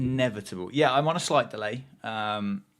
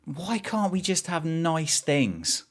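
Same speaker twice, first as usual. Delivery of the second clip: higher, slow plaintive whine; despairing lament; 7 semitones higher, slower than usual. Real, not acted. Fan lamenting to viewers, slow high plaintive whine. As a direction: plead to the heavens.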